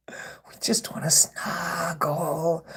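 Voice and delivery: slow, teasing whisper; mock whisper. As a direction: breathe it slowly like a creepy lullaby. Mock-tender taunt to trolls.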